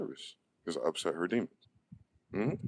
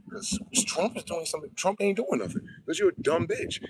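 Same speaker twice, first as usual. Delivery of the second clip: loud, high furious ranting; real shouting outburst, swearing hard; angry rant; 8 semitones higher, 6 dB louder than usual. Real, not acted. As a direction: yell it at them, fed up. Ranting at imagined critics.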